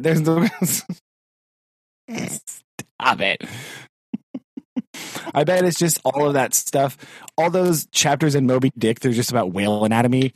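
ordinary talk, nothing marked

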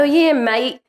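put-on high, light Australian accent; chirpy Australian accent. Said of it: "You could do a good Australian accent".

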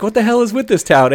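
high-pitched retold exasperation; freaking out. Driver reacting to the snowy wheelchair incident, freaking out. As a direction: act out freaking out at the town. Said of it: "freaking out".

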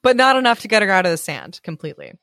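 ordinary delivery

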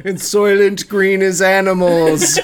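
mock dramatic shout. Movie-line joke shouted.

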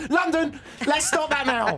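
high, slow and hammed-up, fed up with the guessing game; mock exasperated retort. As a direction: drag it out like you're done with the game. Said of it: "And I'll go".

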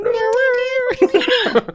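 very high imitated mouse squeaking; squeaky mouse noise. Imitating the mouse in the maze, squeaky. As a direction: squeak away like a little cartoon mouse. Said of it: "a squeaky voice".

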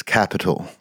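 lightly voiced flamboyant London "capital"; London flamboyant accent. Shown as a demonstration. Friends ask for a taste of the new character, London accent. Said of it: "his catchphrase is".

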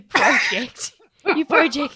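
loud, laughing retry of the name; giggling through the name; laughing at own slip. Real, not acted. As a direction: crack up while repeating it. Tongue-tied over show name, laughing.

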